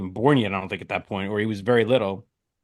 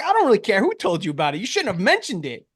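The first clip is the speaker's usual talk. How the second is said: irritated retort; 8 semitones higher than usual. Retold or imitated. Arguing with sister by text.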